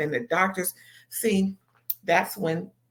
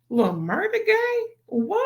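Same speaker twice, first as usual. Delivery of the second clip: much higher, stunned question; shocked disbelief; 13 semitones higher than usual. Retold or imitated. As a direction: gasp at the news in disbelief. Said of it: "she's looking like".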